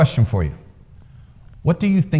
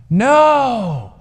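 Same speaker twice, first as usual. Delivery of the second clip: crowd shout; 12 semitones higher, 3 dB louder than usual. A narrator's reading. Crowd answers in unison.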